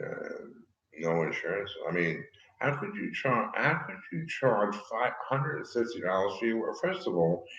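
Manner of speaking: ordinary talk, nothing marked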